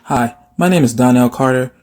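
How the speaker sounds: ordinary delivery, nothing marked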